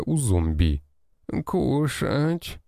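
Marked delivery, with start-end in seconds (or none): 1.5-2.6 zombie moan. A narrator's reading. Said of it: "простонала та с полузакрытыми глазами".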